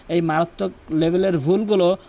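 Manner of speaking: usual speaking voice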